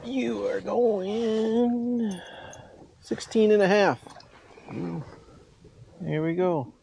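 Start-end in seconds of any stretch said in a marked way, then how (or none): none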